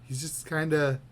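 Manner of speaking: ordinary talk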